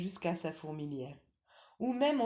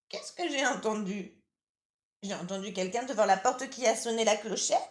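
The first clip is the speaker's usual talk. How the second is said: lightly higher, louder granny voice; old grandmother; 5 semitones higher, 7 dB louder than usual. Acted. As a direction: call out as a drowsy old granny from bed. Grandmother answers the door.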